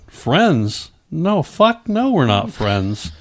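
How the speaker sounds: spits out no, rising high and slowed down; reliving the fury at the old friend; angry refusal. Retold or imitated. Refusing the friend who slept with his wife, angry.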